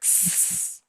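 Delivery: a drawn-out sss hiss; baby dragon speech heard as a hiss. Acted. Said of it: "it sounds like".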